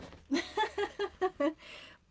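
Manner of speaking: high, emotional laughter; real teary-edged laughing fit; choked-up laugh. Real, not acted. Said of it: "still so choked up".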